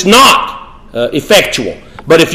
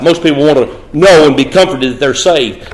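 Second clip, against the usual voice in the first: usual speaking voice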